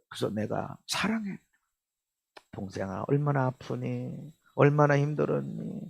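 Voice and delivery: soft, low, tender words to a hurting brother; hushed, loving reassurance; tender love. Shown as a demonstration. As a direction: gently comfort him, nearly whispering. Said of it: "그러고 해줘야 돼".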